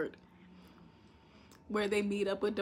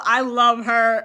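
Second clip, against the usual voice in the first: ordinary delivery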